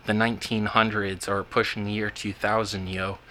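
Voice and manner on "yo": ordinary talk, nothing marked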